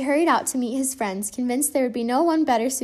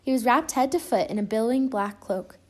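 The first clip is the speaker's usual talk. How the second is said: plain reading voice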